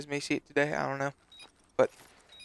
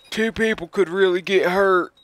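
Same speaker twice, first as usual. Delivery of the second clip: higher, louder mock whiny voice; mocking the manager; 4 semitones higher, 6 dB louder than usual. Teen mocks the Walmart manager's warning, whiny mock voice. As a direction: parody a fussy manager.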